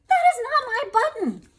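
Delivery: high, loud whiny cry; cried out. A narrator's reading. Toad rejects the button in the woods, high loud cry. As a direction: Toad wails it in frustration. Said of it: "cried Toad".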